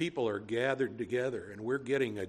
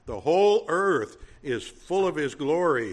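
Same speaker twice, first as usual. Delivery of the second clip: louder proclamation; calling out; 2 semitones higher, 6 dB louder than usual. Acted. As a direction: proclaim it aloud as a seraph would. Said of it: "calling out".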